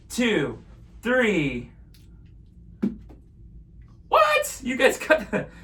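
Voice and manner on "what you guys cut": high astonished "What?"; surprised. Pretend. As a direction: act amazed. Astonished at trick.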